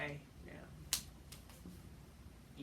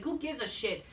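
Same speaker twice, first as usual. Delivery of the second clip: usual speaking voice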